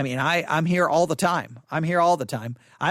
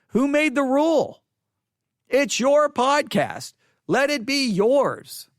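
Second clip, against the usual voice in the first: usual speaking voice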